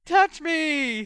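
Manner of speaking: mock swooning falsetto, loud; high-pitched cry. A narrator's reading. George mocking Hermione's letter, high-pitched cry. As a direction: cry it in a high, girlish mockery. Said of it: "cried in a high-pitched voice".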